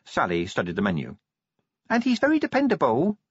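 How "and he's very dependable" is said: put-on high, earnest girlish voice; Tawny, earnest. A narrator's reading. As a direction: insist sweetly. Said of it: "said Tawny".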